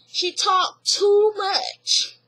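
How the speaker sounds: very slow, drawn-out put-down; dismissive. Retold or imitated. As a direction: drag it out, unimpressed. Mama Joyce voiced dismissive.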